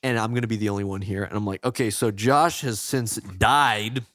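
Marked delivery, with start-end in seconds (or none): 1.6-4.0 slow, deadpan mock announcement; mock solemn host. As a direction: pretend to carry on the show after a death. Mock podcast announcement of death.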